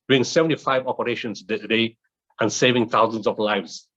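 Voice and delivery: normal talking voice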